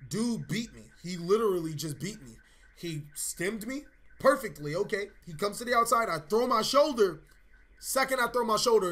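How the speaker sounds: ordinary delivery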